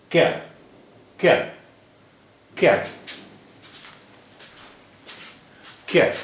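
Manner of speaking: क्या as one syllable, no vowel between k and y; bare k running straight into ya in क्या; joined k-ya. Shown as a demonstration. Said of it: "क के बाद कोई vowel नहीं आया".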